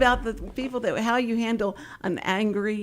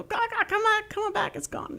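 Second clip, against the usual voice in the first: mock crow call; 12 semitones higher than usual. Neighbor calling the crows back.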